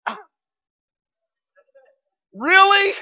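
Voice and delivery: high, loud, whiny groan of dismay; exasperated really. Retold or imitated. Husband moaning to his wife about the store, high and loud. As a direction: act out the husband's dread at the errand. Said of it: "I go".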